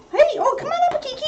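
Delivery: high, coaxing call to the dog; high puppy call. Real, not acted. Owner calling puppy Kiki up, high coaxing voice.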